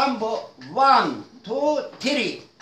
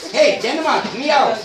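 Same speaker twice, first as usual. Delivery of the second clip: ordinary talk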